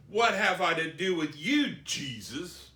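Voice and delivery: acted loud challenge; crying out loudly. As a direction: call it out as the spirit confronting Jesus. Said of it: "He cried out with a loud voice".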